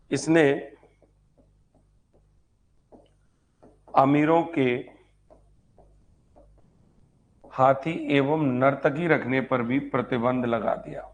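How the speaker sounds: teacher dictating to a class, pausing for pens; dictating notes. Shown as a demonstration. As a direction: read it out phrase by phrase so students can write.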